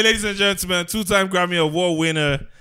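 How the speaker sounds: ordinary delivery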